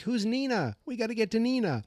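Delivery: high-pitched urgent confusion; mock alarm. Kids puzzling over the dying man's clue, high and urgent. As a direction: imitate baffled kids, voice way up.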